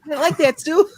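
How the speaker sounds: high falsetto swoon; breathy-free high girlish gush; swooning woman voice. Acted. Swooning over Thing's body.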